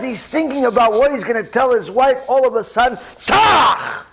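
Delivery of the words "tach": high loud tach for the elbow; sharp jab sound. Acted. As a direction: crack out the impact noise.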